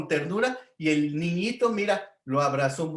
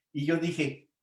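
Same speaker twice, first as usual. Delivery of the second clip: ordinary talk, nothing marked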